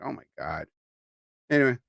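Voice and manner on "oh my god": soft exasperated mutter; muttered aside. Real, not acted. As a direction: drop it to a muttered groan.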